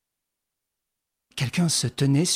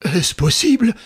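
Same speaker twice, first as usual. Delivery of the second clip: startled cry, louder; exclaiming; 4 semitones higher, 5 dB louder than usual. A narrator's reading. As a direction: gasp it out in disbelief. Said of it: "s'écria le professeur".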